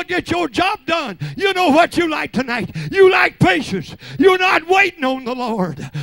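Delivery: high, loud shouted preaching; full-force preaching. Real, not acted. Preacher rousing the saints at night service, shouted preaching. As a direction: whip the church into fervor.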